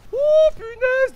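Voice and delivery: a sudden gasp of surprise; surprised cry. Real, not acted. Reacts to drop.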